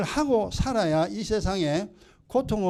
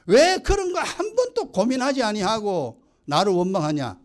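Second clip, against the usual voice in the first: God scolding; 5 semitones higher than usual. Retold or imitated. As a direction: rebuke a stubborn child from above. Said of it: "하나님 그럽니다".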